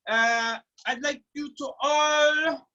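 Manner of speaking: ordinary delivery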